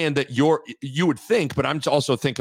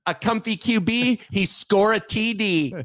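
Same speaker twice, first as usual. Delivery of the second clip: clueless housewife voice; 8 semitones higher than usual. Retold or imitated. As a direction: say it like a housewife who knows nothing of football. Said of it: "deliver it like it's a housewife that doesn't know anything about football".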